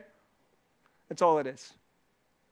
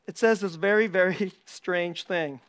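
normal talking voice

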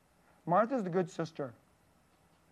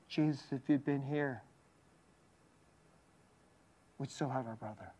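soft, broken, near-tearful plea; a hint of weeping, fading to almost nothing; mock sobbing; 3 semitones lower, 8 dB quieter than usual. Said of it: "bawling like crazy".

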